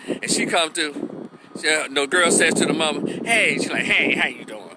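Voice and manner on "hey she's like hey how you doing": with attitude. Retold or imitated. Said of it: "Already tuned up with attitude".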